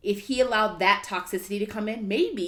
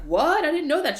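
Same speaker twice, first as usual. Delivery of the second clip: high, loud mock surprise; shocked gasp; 10 semitones higher, 7 dB louder than usual. Mom hearing the abuse news for the first time, loud high shock. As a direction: act stunned by the news. Mom's shocked reaction retold.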